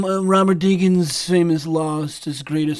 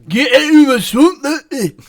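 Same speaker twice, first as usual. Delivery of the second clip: higher, louder Scottish ogre; Scottish accent; 7 semitones higher, 8 dB louder than usual. Shown as a demonstration. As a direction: bark the line like Shrek.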